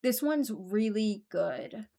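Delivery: ordinary delivery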